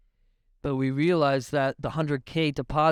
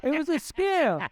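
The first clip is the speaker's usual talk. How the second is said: slow, much higher, squeaky; 13 semitones higher than usual. Real, not acted. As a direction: squeak the punchline out slowly. Repeating the scam punchline.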